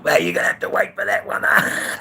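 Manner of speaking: high, loud, quick mock retort; hammed-up retold yell, far up in pitch; mocking rebuttal. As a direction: crow it out like a taunt. Acts out his rebuttal to biker.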